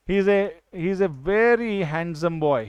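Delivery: slow, even reading of the example sentence. Shown as a demonstration. Teacher reading an example sentence slowly. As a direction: spell the sentence out slowly for the class. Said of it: "handsome क्या है?".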